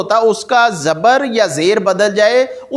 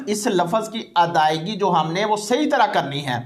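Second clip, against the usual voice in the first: ordinary talk, nothing marked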